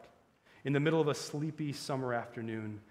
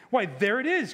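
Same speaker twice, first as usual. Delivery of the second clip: higher, louder cry of recognition; excited cry; 9 semitones higher, 9 dB louder than usual. A narrator's reading. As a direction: cry it out as if it suddenly came back. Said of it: "cried the prince".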